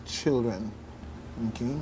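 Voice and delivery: ordinary delivery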